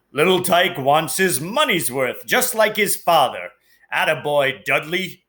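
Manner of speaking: lightly voiced gruff proud father; a hint of proud Uncle Vernon. A narrator's reading. Father praises his greedy son, lightly voiced pride. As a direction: praise the boy heartily.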